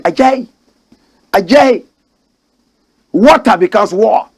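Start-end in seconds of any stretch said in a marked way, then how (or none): 0.0-0.5 Adjaye said ad-JAY with a British lilt, rising; mock British. Mocking how the British say Adjaye. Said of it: "you know how British people speak". 1.3-1.8 put-on Adjaye repeated in a light British accent; mock British. Mocking how the British say Adjaye. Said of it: "you know how British people speak".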